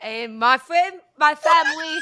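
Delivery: loud hammed-up Boston voice; Boston accent. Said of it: "Boston accent or British?".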